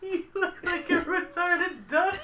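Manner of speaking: high, slow, laughing fake sob; fake crying. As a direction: whine it through a mock cry. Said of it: "When you fake cry like that".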